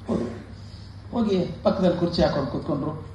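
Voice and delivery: usual speaking voice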